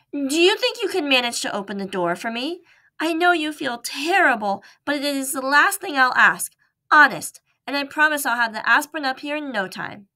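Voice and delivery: high, urgent pleading mouse voice. Acted. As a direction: beg earnestly, rushing the promise. Mouse pleading with sick boy.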